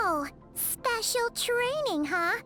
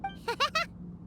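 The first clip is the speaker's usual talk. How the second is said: short, light, rising giggle; eager giggle; 5 semitones higher, 5 dB quieter than usual. Acted. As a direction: giggle before taking up a challenge. Sidekick eager for training.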